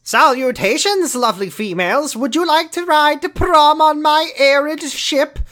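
high, slow grandiose proclamation; hammy steampunk suitor. Pretend. Steampunk man inviting girls to prom, hammed-up grandeur. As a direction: stretch it out like a pompous gentleman caller.

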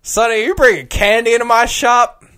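high, loud mock-indignant barkeep; gruff bartender. Bartender scolds the cowboy for bringing candy, loud and high. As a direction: scold the stranger like an offended old barkeep. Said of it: "The bartender looks at him and goes".

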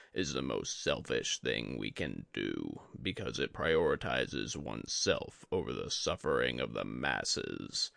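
a dying sensei, calm and heavy; Yori the old master. A narrator's reading. Yori lectures his student.

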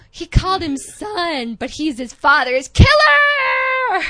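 rising to a high, loud outraged shout. Real, not acted. Podcaster outraged that the killer called him son, shouting. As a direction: build from indignant to shouting in disbelief.

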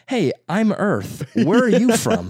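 high, slow, mocking sarcasm, breaking into a laugh; mock the girl in a high, laughing voice; sarcastic mock. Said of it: "And I was like".

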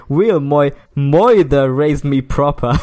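loud, slow reading with MOITHER said with an "oy" vowel. Acted. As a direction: lean hard on the "oy" in "moither". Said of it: "he just substitutes oi for I".

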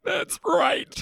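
high put-on goofy voice; silly character voice. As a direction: stay in the made-up brother voice. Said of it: "How long do you think I could keep that voice up".

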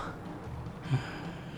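long weary unvoiced sigh; exasperated sigh. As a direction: sigh out all your patience. Cashier tired of picky order.

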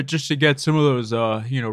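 usual speaking voice

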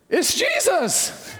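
high, slow, laughing it's Jesus; laughing joyful answer. Retold or imitated. Pastor laughing his answer to a stranger's question. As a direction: let the joy spill out as you laugh the words. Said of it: "I just said".

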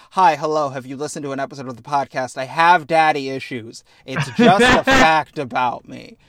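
mock oversharing. Mocking Zagreus's oversharing.

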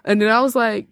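normal talking voice

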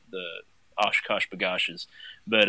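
usual speaking voice

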